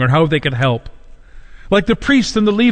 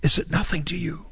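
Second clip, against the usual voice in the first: much softer, subdued question; hushed repeat; 9 dB quieter than usual. Acted. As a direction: drop to a quiet, searching plea. Same cry repeated, hushed, after pause.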